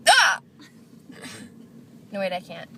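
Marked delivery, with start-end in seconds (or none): 0.1-0.4 high squeaky hiccup; real hiccup yelp; loud hiccup. Real, not acted. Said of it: "I'll show you".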